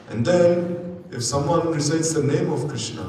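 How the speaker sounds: ordinary talk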